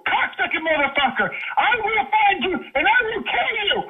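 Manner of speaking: high-pitched screamed threats, climbing to breaking point; enraged screaming. Real, not acted. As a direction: scream it with total fury down the phone. Enraged threats over wife and kids.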